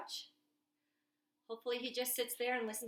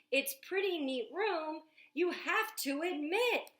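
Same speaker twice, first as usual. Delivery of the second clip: put-on louder, showing off the kitchen to the kids; eager storytime reading; 5 semitones higher, 5 dB louder than usual. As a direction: sell the gadget like an excited boy. Reads Jack's lines aloud.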